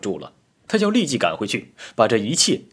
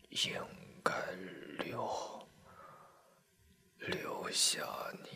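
barely audible whisper, broken by long pauses; faint, fragmented whisper of a dying soldier; halting, dying; 16 dB quieter, slower than usual. A narrator's reading. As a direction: gasp out each word with last strength. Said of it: "断断续续地说".

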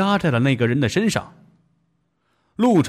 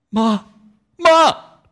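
loud, high wailing Mom; anguished cry; 8 semitones higher, 5 dB louder than usual. Acted. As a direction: cry out as she dies. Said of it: "他喊道".

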